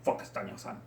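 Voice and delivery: normal talking voice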